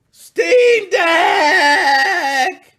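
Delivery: shouts the name out high and loud, stretched; excited shout. Real, not acted. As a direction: hype it up like an announcer. Hyped announcement of topic.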